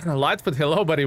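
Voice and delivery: ordinary delivery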